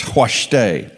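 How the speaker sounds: "Huashteh" with a clear H sound first, hwahsh-tay; breathy H onset. Shown as a demonstration. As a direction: start "Huashteh" on an audible H. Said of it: "Has an H sound first".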